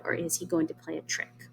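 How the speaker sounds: teacher's whispered hint of danger; suspense whisper. Shown as a demonstration. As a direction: whisper the question to build suspense. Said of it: "teacher stages suspense".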